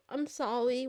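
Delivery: ordinary talk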